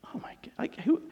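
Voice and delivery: whispered shock; acted breathless dismay; horrified whisper. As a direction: whisper it as realization hits. Acting shock after rooster crows.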